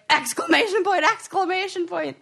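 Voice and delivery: high-pitched imitation of everyone chiming in; mock clamor. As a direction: call it out high and insistent, like a crowd. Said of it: "everybody was like".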